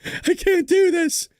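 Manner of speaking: high, slow whiny complaint; exasperated self. Retold or imitated. To his audience about the late-night news, mock whining. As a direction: whine that you can't keep up. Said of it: "And I'm like".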